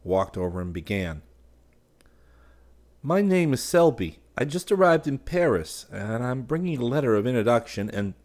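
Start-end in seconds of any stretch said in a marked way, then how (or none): 3.0-8.1 put-on a light, higher, nervous young voice; hesitant newcomer, higher pitch; shy nervous young man. A narrator's reading. Newcomer introducing himself to a painter, nervous. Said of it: "Selby, shy and nervous".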